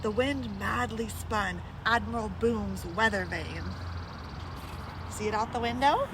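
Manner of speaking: ordinary delivery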